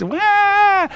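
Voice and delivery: high squeaky lamb cry; lamb's bleat. Retold or imitated. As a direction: squeal it like a frightened baby lamb.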